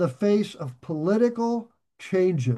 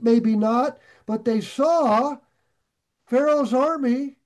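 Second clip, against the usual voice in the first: fervent preaching; 6 semitones higher, 4 dB louder than usual. Acted. Fervent preaching about Red Sea.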